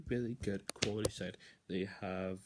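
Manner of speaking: ordinary delivery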